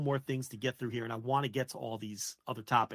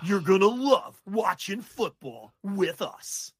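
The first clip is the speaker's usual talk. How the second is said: very slow, louder hammed-up broadcaster sign-off; Syracuse big boy voice; 5 semitones higher, 5 dB louder, much slower than usual. Imitating the Red Zone host's salesy podcast sign-off. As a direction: stretch every word like a TV promo. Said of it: "he was using his Syracuse big boy voice".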